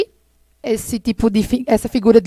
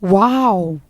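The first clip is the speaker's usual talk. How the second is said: a low, drawn wow of wonder; amazed wow; 4 semitones lower than usual. Shown as a demonstration. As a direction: say it like you're impressed. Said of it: "ela quer expressar o quê?". (uau admirado)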